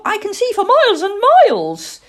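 high, loud, building boast; young owl Plop, boasting. Acted. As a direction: brag like an excited child. Now, don't boast.

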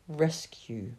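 whispered chapter title. A narrator's reading. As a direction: breathe the word like a secret.

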